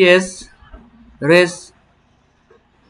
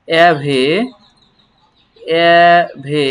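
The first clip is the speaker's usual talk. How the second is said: stretched very slowly; very slow teacher demo; drawn-out syllable; 5 dB louder, much slower than usual. Shown as a demonstration. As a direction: hold each sound for the class. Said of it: "teacher models the syllable".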